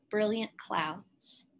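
plain reading voice

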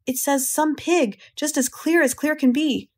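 high, emphatic marvel; stressing clear with wonder; excited insistence. Acted. Zuckerman excited about sign.

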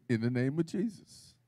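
just say it softly, no show; quiet plain version. Shown as a demonstration. Preacher shows the low-key way to rebuke, quiet. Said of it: "you ain't gotta say it. You can say".

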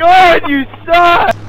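loud, outraged groan of a shout; exasperated yell. Real, not acted. Gamer yelling at Dustin after the crash, exasperated yell. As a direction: blast your buddy for blowing it.

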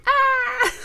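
very high, loud happy squeal; delighted squeal. Real, not acted. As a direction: burst out in a joyful squeal. Can't stop looking at them.

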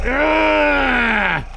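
long high held "aah" under a massage gun; sustained massage-gun groan; drawn-out "ah". Shown as a demonstration. Groaning while using massage gun.

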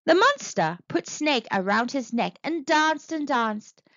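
usual speaking voice

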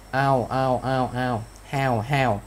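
"how" repeated to show its "ao" tail; "how" in pairs, each ending on an open voiced vowel; vowel ending demo. Shown as a demonstration. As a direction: repeat "how" evenly, sounding out the final glide. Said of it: "cái đuôi của cái từ how".

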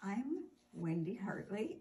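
ordinary delivery

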